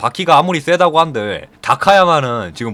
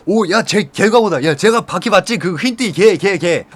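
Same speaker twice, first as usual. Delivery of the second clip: high, excited chatter of reporters; excited crowd; 6 semitones higher than usual. Retold or imitated. Reporters spotting the kid from yesterday, excited chatter. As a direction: point him out all worked up.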